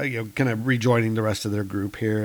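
usual speaking voice

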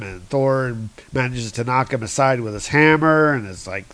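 ordinary delivery

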